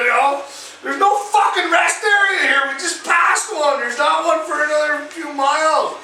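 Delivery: retold exasperation, high and insistent; high-pitched protest, re-enacted; exasperated reply. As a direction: argue back as the helpless driver. Acting his exasperated reply to wife.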